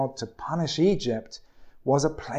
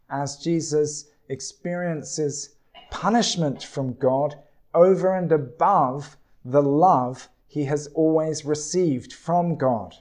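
ordinary delivery

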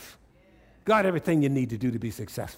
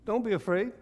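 lightly voiced Jesus, higher and reassuring; a hint of Jesus calming frightened disciples, pitched up; 8 semitones higher than usual. Preacher voicing Jesus to scared disciples, lightly higher.

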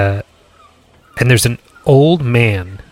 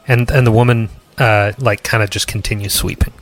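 ordinary talk

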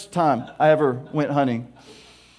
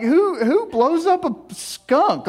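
much higher, slow incredulous question; 9 semitones higher, much slower than usual. Real, not acted. Laughing at his own rifle idea, incredulous. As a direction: stretch it out in disbelief.

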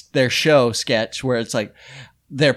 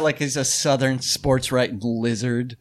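usual speaking voice

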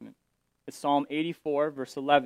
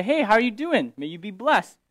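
breezy everyday hello, pitched up; a light, chatty hey how are you; casual modern greeting; 6 semitones higher, 4 dB louder than usual. Retold or imitated. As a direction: greet a friend in passing, chirpy. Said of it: "We say".

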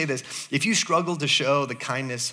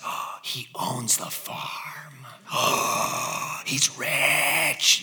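slow, breathy gasp of discovery; mock-awed hushed swoon; swooning whisper; 2 semitones lower, 5 dB quieter, much slower than usual. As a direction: whisper it like a dreamy, awed movie fan. Acting out the movie viewer's swooning realisation.

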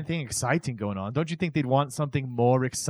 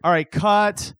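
higher, louder "All right, cut." called across a set; director calling cut; 5 semitones higher, 6 dB louder, slower than usual. Retold or imitated. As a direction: call "cut" like a film director. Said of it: "Then you hear".

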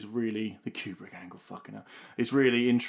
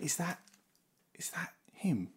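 hushed question; 6 semitones higher, 8 dB quieter than usual. Retold or imitated. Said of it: "he went".

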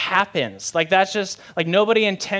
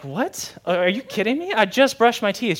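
indignant disbelief; 5 semitones higher than usual. Retold or imitated. Husband told his breath stinks, indignant. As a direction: protest in mock offence, pitch jumping up.

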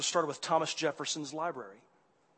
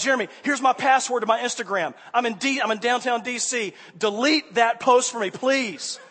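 urgent; 5 semitones higher, 6 dB louder than usual. Retold or imitated. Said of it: "I was like".

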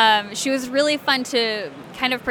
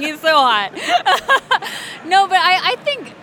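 louder, through giggles; laughing; 7 semitones higher, 5 dB louder than usual. Real, not acted. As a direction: gush it while laughing. Giggling after joking about Thor.

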